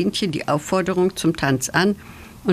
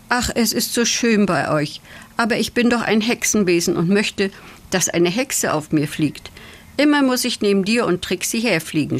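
usual speaking voice

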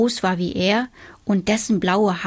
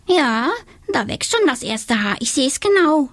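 teasing little witch; 6 semitones higher than usual. A narrator's reading. Donatella fooling Tobias about blue hair, mock alarm. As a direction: pretend to be shocked.

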